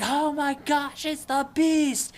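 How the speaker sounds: high, loud, drawn-out panic; mock terror. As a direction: cry out in hammed-up horror. Boys terrified by dead paratrooper.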